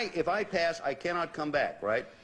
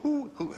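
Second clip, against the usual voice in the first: high, hooted "hoo, hoo"; imitated owl hoot; 13 semitones higher than usual. Shown as a demonstration. Player clues partner to an owl's noise, high imitated hoot. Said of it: "That was a sound".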